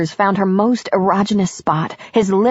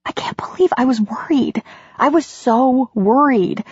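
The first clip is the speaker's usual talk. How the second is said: high, breath-caught confession; emotional heroine; 7 semitones higher than usual. A narrator's reading. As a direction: let emotion lift the voice, slowing on "so worried". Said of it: "a little hiccup of emotion".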